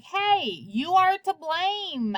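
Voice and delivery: high, slow voicing of the nurse; scolding nurse. Nurse blaming the father, in a high, slowed scolding voice. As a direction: play the nurse wagging a finger, drawn out and high.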